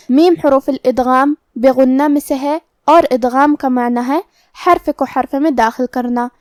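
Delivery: ordinary talk, nothing marked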